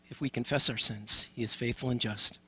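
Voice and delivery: normal talking voice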